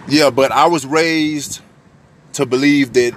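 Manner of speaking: normal talking voice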